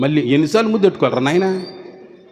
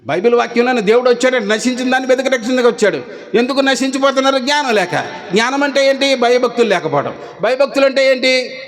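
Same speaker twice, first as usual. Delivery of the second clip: raised, driving preacher's voice; preaching; 5 semitones higher than usual. Acted. Pastor rousing his congregation with questions, preaching.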